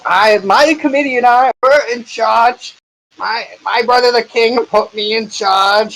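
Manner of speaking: high, slow, indignant official; pompous senator oratory. Acted. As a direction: protest like an outraged nobleman. Pompous magistrate insisting.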